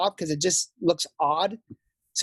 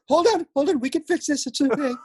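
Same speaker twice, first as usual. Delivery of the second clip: higher, hurried-to-reassure mock voice; imitated damage control; 7 semitones higher, faster than usual. Mimicking the boss after the glitch, mock reassurance. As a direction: play someone talking down a panic, higher. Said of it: "He's like".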